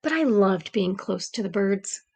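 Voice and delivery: gentle read-aloud storytelling voice; story narration. A narrator's reading. Teacher reading a storybook to the class, gentle narration. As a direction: read it like a bedtime picture book.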